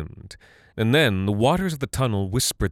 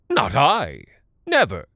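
lying denial; 4 semitones higher than usual. Acted. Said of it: "Talarn lied".

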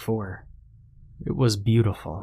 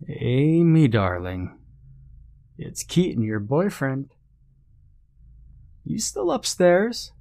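singsong mock-sweet call; taunting; 5 semitones higher than usual. A narrator's reading. Pursuer poses as boyfriend to hidden girl, taunting. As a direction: coax teasingly, swooping high at the end. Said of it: "he taunted".

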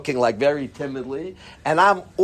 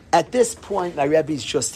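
ordinary delivery

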